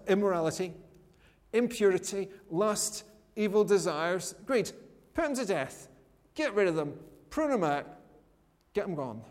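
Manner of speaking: ordinary talk, nothing marked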